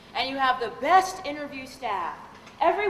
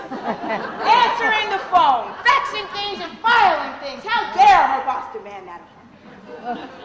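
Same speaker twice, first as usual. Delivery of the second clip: slow, louder, sarcastic outrage; mock indignation; 4 semitones higher, 6 dB louder, slower than usual. Speaker mocking the receptionist's complaint, sarcastic slow outrage. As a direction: drag out each duty with sarcasm.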